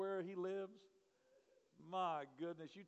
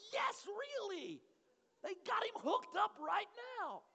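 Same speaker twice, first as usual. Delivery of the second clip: high, loud, slow frantic insistence; high frantic emphasis; frantic insisting; 8 dB louder, slower than usual. Retold or imitated. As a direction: insist in a panic. Insisting in panic to pastor.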